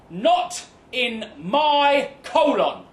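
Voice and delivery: slow, emphatic word-by-word declaration; imitating Karen, every word its own sentence; full stop between words. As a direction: put a heavy pause after each word. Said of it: "puncturing the air between the words with doughy full stops".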